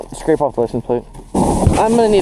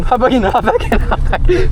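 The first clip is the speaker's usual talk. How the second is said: excited; 6 semitones higher than usual. Real, not acted. Urgently calling friend back.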